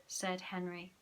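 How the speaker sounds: ordinary delivery, nothing marked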